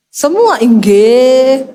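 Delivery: very slow, drawn-out agreement; obedient wife. Retold or imitated. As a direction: say yes to everything like a dutiful wife. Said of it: "Istri yang luar biasa. Melayani papa tuh kayak raja".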